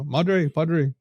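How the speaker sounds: madre and padre in a Spanish accent. Shown as a demonstration. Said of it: "I say it in that accent".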